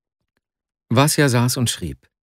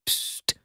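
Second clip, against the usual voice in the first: sharp, soft "psst"; unvoiced shushing; 9 dB quieter than usual. A narrator's reading. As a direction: hush the chatterer. Master hushes the chattering servant. (leises, zischendes Psst)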